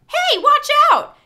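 yelled warning. A narrator's reading. Cassie warning Tobias off her head, loud high yell. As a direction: shout the warning across the barn. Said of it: "Cassie yelled".

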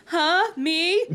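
high, loud startled questions; surprised. A narrator's reading. Chris is suspected by her sister, loudly surprised. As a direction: jump up in pitch, taken aback. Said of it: "Chris reacted with surprise".

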